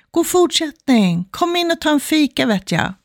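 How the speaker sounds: acted neighbour's call, pitched well up; friendly calling out. Favourite neighbour inviting her in from the doorway, calling out. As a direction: call the invitation out high, dropping at the end. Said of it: "favoritgrannen öppnar dörren och ropar".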